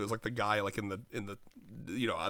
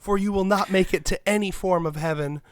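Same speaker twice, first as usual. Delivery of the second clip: mock menacing threat; 6 semitones higher, 7 dB louder than usual. Shizuka threatening the attacker, hammed-up doom. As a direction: threaten like a vengeful avenger.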